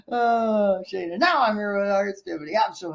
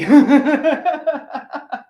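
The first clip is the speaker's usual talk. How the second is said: laughing; 3 semitones higher, 7 dB louder than usual. Real, not acted. Laughs at his own hype.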